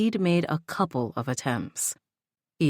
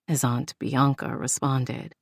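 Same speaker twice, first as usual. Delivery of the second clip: usual speaking voice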